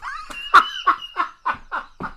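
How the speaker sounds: high-pitched helpless laughing fit; cracking up. Real, not acted. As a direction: lose it at your own impression. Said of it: "that's cracking me up so bad".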